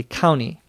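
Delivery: county without its T, "couny"; quick, dropped T. Shown as a demonstration. Teacher showing listeners quick speech, dropped T in county. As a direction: glide from N straight to Y in county. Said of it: "saying that quickly".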